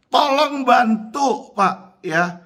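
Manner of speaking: each word stretched out slowly; slow, emphatic appeal for help; drawn-out plea. Real, not acted.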